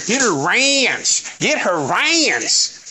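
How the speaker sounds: drawn-out southern "Get her ranch", slow; mock her southern drawl on "ranch"; imitating a southern accent. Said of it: "You and that southern accent".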